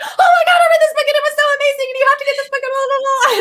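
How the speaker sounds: mock ecstatic reviewer screaming praise; raving reader. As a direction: shriek it like an ecstatic fan. Imitating a reader raving online.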